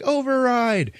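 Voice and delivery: high-pitched frantic order; acted desperate command. She overrules the auto dock, mock-desperate cry. Acts her ordering the machine.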